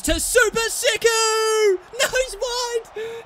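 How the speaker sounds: high, loud drawn-out yell at the chance; excited shout. Real, not acted. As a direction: scream the player's name as he shoots. Scoring chance, screaming at the game.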